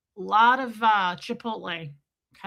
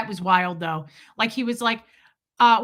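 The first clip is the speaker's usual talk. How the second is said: usual speaking voice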